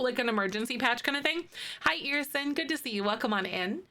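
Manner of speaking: ordinary talk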